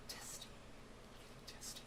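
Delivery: breathy whisper, almost silent. Acted. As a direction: whisper the word to yourself. Echoing his own word softly.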